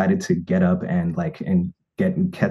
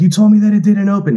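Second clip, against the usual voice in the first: much higher and louder, astonished; excited outburst; 14 semitones higher, 9 dB louder than usual. Retold or imitated. Discovering the hidden latch, loud astonished. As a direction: blurt it out loud in disbelief. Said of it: "was like".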